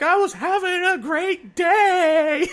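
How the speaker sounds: high, loud, slow crying wail; mock sobbing. Mocking her own sobbing while talking about breakdowns, crying wail. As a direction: wail it like a sob mid-breakdown. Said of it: "sobbing and you're like".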